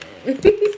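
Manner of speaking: high burst of delighted laughter; excited laugh. Real, not acted. Reader laughing at her own correct prediction, excited laugh. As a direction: laugh out loud with glee. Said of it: "I get excited".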